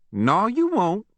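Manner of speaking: high mocking taunt; Granny taunting the boy's threat to leave, high. A narrator's reading. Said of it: "she said tauntingly".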